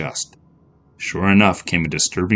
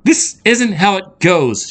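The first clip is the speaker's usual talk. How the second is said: high, slow, upset voice; distressed, drawn-out objection; tearful protest; 13 semitones higher, 5 dB louder, slower than usual. A narrator's reading. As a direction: protest through tears. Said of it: "Andrea felt the tears".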